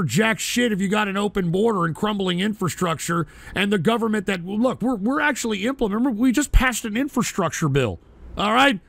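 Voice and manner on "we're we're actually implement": ordinary delivery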